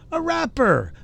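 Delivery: a rapper, pitched high and loud in disbelief; mock shock at the word rapper; incredulous repeat. Real, not acted. Repeating a rapper in scornful disbelief.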